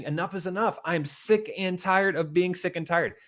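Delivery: fed-up declaration. Shown as a demonstration. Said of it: "just say".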